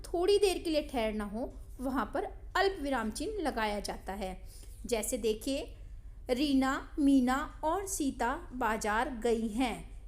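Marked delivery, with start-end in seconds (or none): 6.3-10.0 short stop after "Reena", then "Meena और Sita"; slowly read, with a comma break after Reena; pause after Reena. Shown as a demonstration. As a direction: let "Reena" hang briefly before going on to Meena. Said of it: "Reena के बाद थोड़ा रुकना पड़ा".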